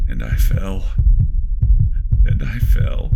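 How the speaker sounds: loud, high panicked narration; falling scream. Acted. Narrator falling down the borehole, shouting in terror. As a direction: shout it as you fall.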